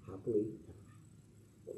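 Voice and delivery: very soft, low fairy-tale close; hushed storybook ending. Acted. Minister closes the children's story softly, like a bedtime tale. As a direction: trail off gently into the ending.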